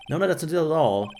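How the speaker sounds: usual speaking voice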